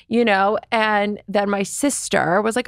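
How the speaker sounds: ordinary talk, nothing marked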